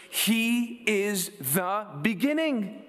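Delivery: slow right down, landing each word of "He is the beginning"; "He is the beginning" high, slow and deliberate; emphatic scripture reading. Real, not acted. Key phrase in scripture reading.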